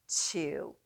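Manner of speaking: usual speaking voice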